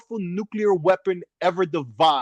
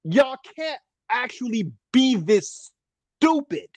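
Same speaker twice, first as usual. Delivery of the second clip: incredulous; 8 semitones higher than usual. Acted. Incredulous rant.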